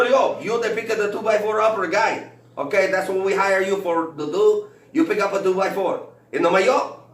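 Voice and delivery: put-on high, loud Mexican accent, repeated. Coworker on construction site correcting him, high and loud in an accent. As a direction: insist it like a coworker scolding. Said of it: "I picked up on the accent".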